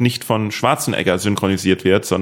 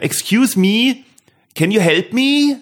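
high, slow put-on voice, English; much higher, drawn-out silly plea in English; prank asking tourist; 10 semitones higher, slower than usual. Schoolboy pranking an English passer-by, silly high plea. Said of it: "zu Leuten gegangen sind und gesagt haben".

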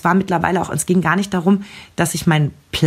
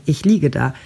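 ordinary talk, nothing marked

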